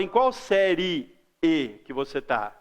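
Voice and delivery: "série E" split, a pause before the detached E; over-separated "séri... E"; wrongly separated vowels. Shown as a demonstration. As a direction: finish "séri", stop, then add "E". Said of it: "a gente não faz essa separação".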